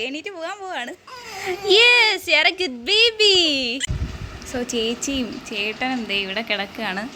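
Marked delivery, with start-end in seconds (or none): 1.7-3.4 high, slow drawn-out praise. Real, not acted. Mother praising her baby, high drawn-out baby talk.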